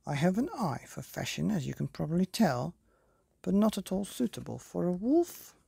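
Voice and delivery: high, chatty fussy fairy; prim fairy godmother. Acted. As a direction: gossip like a vain fairy. Godmother chatters about the dress.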